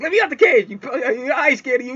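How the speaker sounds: high-pitched talking dog; defiant dog voice. Retold or imitated. Caged dog talking back to the man, high defiant voice. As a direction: yap the words, spoiling for a fight. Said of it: "That, that dog was like".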